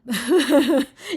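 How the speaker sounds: a burst of loud laughter. Real, not acted. Laughing at the wordy line.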